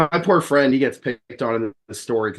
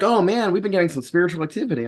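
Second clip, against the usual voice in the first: much higher, fast, worried report; worried friend; 8 semitones higher, faster than usual. Retold or imitated. Friend admitting spirit trouble, higher and fast. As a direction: rush it out, a bit rattled. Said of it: "they're like".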